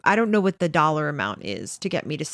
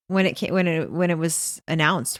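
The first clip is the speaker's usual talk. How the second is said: ordinary talk, nothing marked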